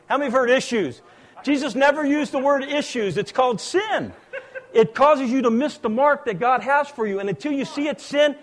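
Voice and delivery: high, forceful pulpit voice; preaching. Real, not acted. Preacher challenging the congregation about calling sin issues, preaching forcefully. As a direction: drive the point into the congregation.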